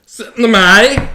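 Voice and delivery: loud, slow, high slurred British voice; drunk British dude. Retold or imitated. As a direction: slur it like a drunk Brit. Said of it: "drunk British dude just being like".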